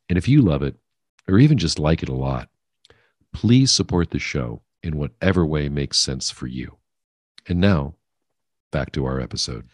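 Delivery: measured, emphatic appeal to listeners; deliberate, punchy announcement read; podcast promo read. Acted. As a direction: read a host's support message, clearly phrased with pauses. Recorded support message to listeners.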